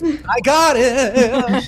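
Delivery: high, loud, very slow; exaggerated reassuring voice. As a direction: stretch it out, big and goofy. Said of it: "I'm like".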